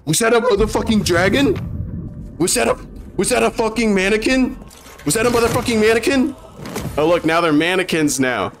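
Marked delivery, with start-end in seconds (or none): 0.0-6.4 incredulous. Acted. Incredulous outburst at the trailer.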